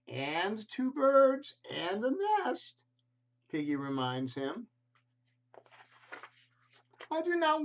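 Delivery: a baffled, put-upon elephant; Gerald the elephant. Acted. Elephant asking his friend Piggy, high and baffled.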